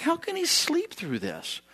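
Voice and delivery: high, stunned cry over the storm; panicked disbelief. Retold or imitated. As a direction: disciples can't believe he sleeps.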